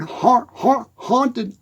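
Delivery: ordinary talk, nothing marked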